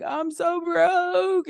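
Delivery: high, very slow mock wail; mock crying. To her accountant, a hammed-up tearful wail. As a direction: whine it like a hammed-up sob. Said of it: "I went to my accountant and I'm crying".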